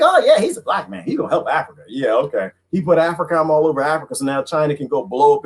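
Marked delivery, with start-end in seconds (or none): 0.0-1.8 mocking believer.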